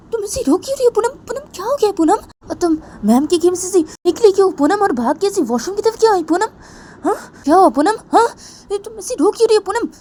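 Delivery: high, loud worried questioning; worried friend. Acted. Pooja anxiously asking crying Poonam what happened. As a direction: press her friend anxiously for answers. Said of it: "पूजा वो पूनम को बोलती".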